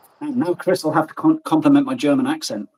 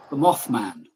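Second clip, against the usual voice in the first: ordinary talk, nothing marked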